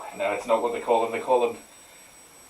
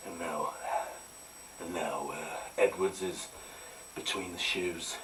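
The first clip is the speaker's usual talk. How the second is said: snooker commentator; 9 semitones lower, 13 dB quieter than usual. Pretend. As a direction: hush it like a snooker broadcast.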